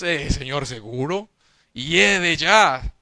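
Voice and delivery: «Uy, Señor, ¿seguro?» más bajo y suave, con duda; Marta dudosa. Retold or imitated. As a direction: titubea incrédula ante la orden. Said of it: "Y nosotros le decimos". (Marta titubeante, voz más baja y vacilante)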